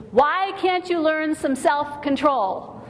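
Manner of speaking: mock scream. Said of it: "screaming at your kids".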